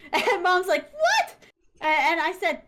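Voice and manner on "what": very high, loud outburst; scandalised high yelp; shocked mother. Retold or imitated. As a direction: shriek it in mock disbelief. Mother's shocked reaction acted out.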